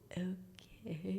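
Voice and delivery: hushed, drawn-out uneasy okay; softly unnerved, stretched out; wary whisper. Retold or imitated. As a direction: whisper it with a questioning lift. Wary reaction to stabbed knife.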